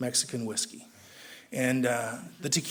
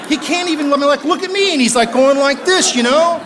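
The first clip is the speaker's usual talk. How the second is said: much higher and louder, building to a squeal; escalating exasperation; 11 semitones higher, 12 dB louder than usual. Retold or imitated. Teller describing the sponsor's wandering eye, ever higher and louder. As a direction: climb higher and louder with every word.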